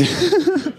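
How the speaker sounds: short high giggle; a quick high laugh. Real, not acted. Laughing at the hidden knives, short high laugh.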